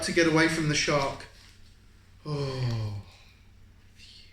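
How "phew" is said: ordinary delivery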